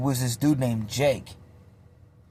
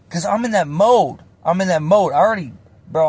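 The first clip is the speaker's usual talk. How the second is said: normal talking voice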